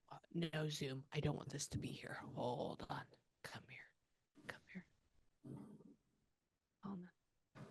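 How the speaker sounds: very soft, half-voiced murmur; muttering aside. Real, not acted. Medium murmuring to unseen spirits to wait, very quietly. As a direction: murmur it to yourself, barely audible.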